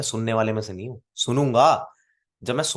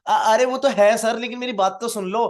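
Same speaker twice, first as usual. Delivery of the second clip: mock panicky pleading; 10 semitones higher, 5 dB louder than usual. A network marketer flusters at an objection, high and pleading. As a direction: play the rep who panics at an objection. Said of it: "कई लोग क्या करते हैं objection के बारे में".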